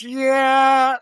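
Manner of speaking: high, drawn-out doubtful "yeah"; hedging yeah. Retold or imitated. Casino boss balks at the clerk's plan, hedging. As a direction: stretch it out high before letting them down.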